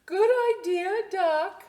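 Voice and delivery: high, loud happy cheer; cheering animals. Acted. Farm animals thanking Doc, high loud cheer. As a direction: call it out like a crowd of animals.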